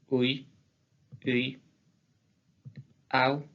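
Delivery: isolated Occitan diphthongs, one at a time; both vowels pronounced. Shown as a demonstration. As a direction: glide through both vowels in "aïe", "au", "iu". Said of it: "Les deux voyelles se prononcent".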